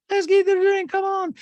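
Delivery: piercingly high, loud caricature; exaggerated mocking quote. Host hams up housewife demanding a drink, very high loud voice. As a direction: push it far up high and loud. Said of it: "and she's like".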